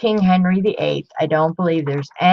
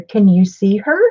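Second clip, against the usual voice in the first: ordinary delivery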